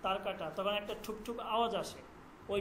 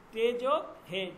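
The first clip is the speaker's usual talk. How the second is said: ordinary delivery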